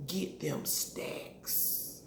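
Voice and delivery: slow, breathy secret whisper of "Get them stacks". Retold or imitated. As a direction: lean in and whisper the order slowly. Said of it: "Mercedes whispers in her ear".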